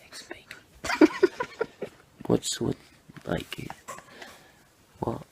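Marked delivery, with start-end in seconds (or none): 0.1-2.2 giggling under the breath; whispered laugh. Real, not acted. Mocking the breakfast plan, laughing whisper.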